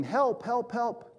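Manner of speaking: high mock cry for help; a climber's yell, held back; retold shout. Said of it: "he starts shouting".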